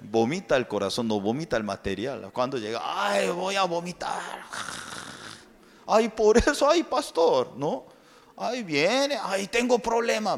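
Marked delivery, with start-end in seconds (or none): 2.8-10.3 high, whiny moaning about feeling sick; a hint of a nauseous believer; queasy complaining member. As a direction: moan to the pastor, high and fretful. Member acting sick, acted.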